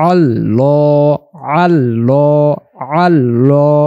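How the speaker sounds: আল্লাহ with a heavy, dark l and rounded mouth. Shown as a demonstration. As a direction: say আল্লাহ slowly with the লাম full and heavy. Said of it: "একটু ভারী আওয়াজে পড়তে হবে".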